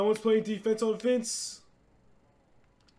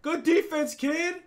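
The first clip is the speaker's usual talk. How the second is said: ordinary talk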